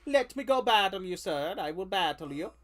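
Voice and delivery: put-on dopey muscle-bound Swedish accent; mock Swedish accent. Player voicing a dim Swedish trainer, thick Swedish accent. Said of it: "I gave him a Swedish accent".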